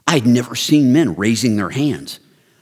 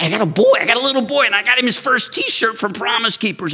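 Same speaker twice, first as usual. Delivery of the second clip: much higher, gushing with excitement; proud dad recalls son's first T-shirt, much higher; overjoyed; 11 semitones higher than usual. Real, not acted. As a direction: gush like a proud new dad. New father buying first T-shirt.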